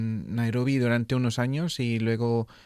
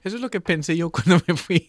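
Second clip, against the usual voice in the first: laughing; 7 semitones higher, 6 dB louder, faster than usual. Real, not acted. Laughing at why Peru.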